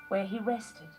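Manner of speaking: usual speaking voice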